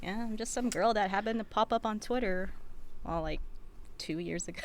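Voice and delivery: normal talking voice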